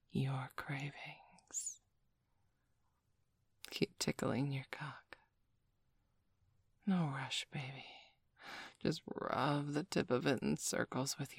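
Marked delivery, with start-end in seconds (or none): none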